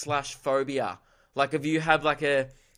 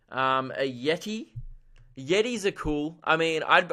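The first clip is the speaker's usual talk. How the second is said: ordinary talk, nothing marked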